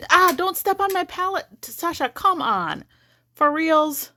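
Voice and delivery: high, exasperated scolding; shooing the cat off. Real, not acted.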